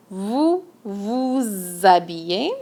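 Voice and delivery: vous vous habillez with the z sound linking vous to habillez; slow, careful vous vouZ-abillez; liaison shown. Shown as a demonstration. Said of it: "Make sure you make a little liaison here".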